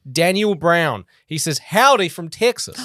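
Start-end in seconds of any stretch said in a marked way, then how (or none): 1.6-2.8 a hint of Texan drawl on Howdy from Texas; slight Texan accent. Shown as a demonstration. Reading a Texan listener's greeting with slight Texan accent. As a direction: slip lightly into a Texan accent, slowly. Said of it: "you drifted into an accent there just a wee bit".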